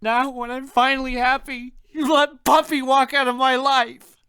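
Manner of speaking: high, loud, wailing accusation; sobbing. A narrator's reading. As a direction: cry it out through a fit of sobs. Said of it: "I dissolve into a fit of sobs".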